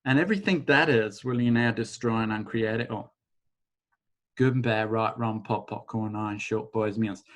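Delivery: soft, rapid ritual recitation; quiet rattled formula. Acted. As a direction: murmur the formula under your breath. Clearing formula again.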